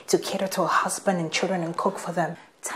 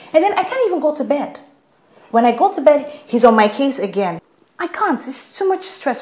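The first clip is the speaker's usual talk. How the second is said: higher, louder, fed-up complaint; exasperated venting; 5 semitones higher, 6 dB louder than usual. Acted. Daughter venting to mother about her marriage, exasperated. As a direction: pour it out, loud and exasperated.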